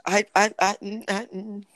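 strangled, choked-up sounds; words stuck in the throat; choking. Real, not acted. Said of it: "You sound like you're choking".

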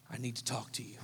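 ominous we-need-to-talk voice; serious tone. Retold or imitated. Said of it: "It was one of those tones".

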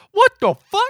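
high, loud startled cry; startled shout. Retold or imitated. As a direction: jolt awake and yell it. Ice-T wakes up, startled.

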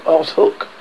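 ordinary delivery

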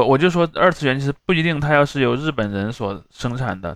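normal talking voice

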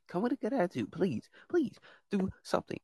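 "Come with a good attitude" pitched far up and hushed; high, soft pleading. Real, not acted. Host begging guests, high soft plea. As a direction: beg it sweetly, high and soft.

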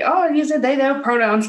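high, bright mock realization; surprised. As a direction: play the sudden realization. Voicing character's reaction.

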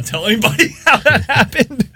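breaking into loud, high laughter; laughing. Real, not acted. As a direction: laugh through the confession. Laughing admission of lucky result.

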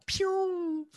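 high squeaky pew like an arrow flying; arrow shot sound. Retold or imitated. Showing how a bow shoots, high pew.

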